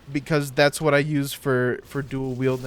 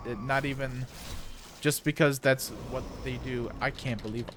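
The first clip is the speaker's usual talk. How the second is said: ordinary talk, nothing marked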